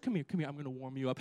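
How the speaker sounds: low, soft mock-soothing voice; cooing comfort, acted out; coddling comfort. Acting out coddling the discouraged.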